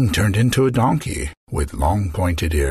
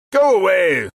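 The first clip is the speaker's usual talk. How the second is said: higher and louder, cranky yell; magician yelling; 13 semitones higher, 3 dB louder than usual. Acted. Magician yelling at the queen to leave. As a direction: bark him off from bed. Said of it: "the magician yelled".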